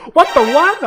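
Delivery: high, loud shriek of disbelief; shout. Acted. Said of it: "I shouted".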